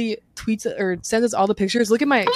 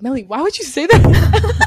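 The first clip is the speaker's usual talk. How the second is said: high, loud, drawn-out protest; re-enacted scolding of a friend; mock dismay; 6 semitones higher, 5 dB louder, slower than usual. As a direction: stretch it out in exasperated disbelief. Retold scolding of friend over remark.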